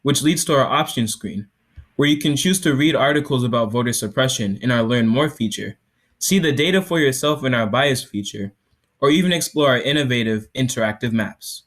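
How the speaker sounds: loud, projected product demo; startup pitch. Acted. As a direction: sell it to the judges.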